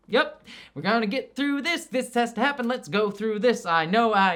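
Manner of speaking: just get through it, no fuss; unbothered resignation. Retold or imitated. Reviewer imitating Loki's resigned push through the task. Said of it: "it's not exasperated".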